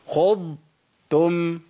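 خضتم very slow, long ض, gap, then تم; separated Dad and Ta. Shown as a demonstration. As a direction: hold the ض of خضتم before a light ت. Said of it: "اسمعوا مني كده".

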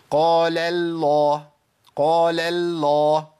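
قال الله with the first لام of الله thinned too, slowly; thinned Allah lam. Shown as a demonstration. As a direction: let the thin لام of قال bleed into الله. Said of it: "رققتها كويس قوي".

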